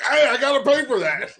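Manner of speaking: high, loud mock yell. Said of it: "I'm like".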